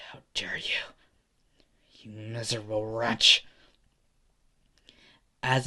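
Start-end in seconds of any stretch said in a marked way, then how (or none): 0.1-3.4 breathy near-whisper swelling at the end; seething rage. Acted. Hasashi to Endeavor after punching him, seething breathily. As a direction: start under the breath, then spit the insult.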